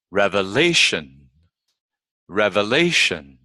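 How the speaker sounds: REVELATION as reve-LA-tion; careful REVELATION, peak on LA then fall; penultimate stress. Shown as a demonstration. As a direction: lift the pitch on LA in REVELATION. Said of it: "the second syllable from the end".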